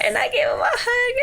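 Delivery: very high, loud, squealing delight; gushing fangirl. Real, not acted. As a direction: squeal it out, nearly overcome with excitement. Gushing over hugging the idol.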